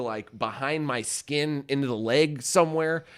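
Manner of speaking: normal talking voice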